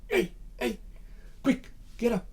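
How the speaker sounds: high, half-whispered urgency; urgent hushed wake-up. Acted. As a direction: shake him awake with your voice, quietly. Urgently waking a sleeping comrade.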